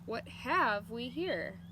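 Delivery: slow and loud, drawing it out; slow curious rumble. A narrator's reading. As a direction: lean in, curious and amused. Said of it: "asked Bounder".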